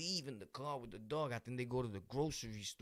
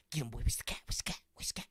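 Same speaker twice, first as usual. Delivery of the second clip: egging on a dog; much faster than usual. Retold or imitated. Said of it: "And you train your dog to be".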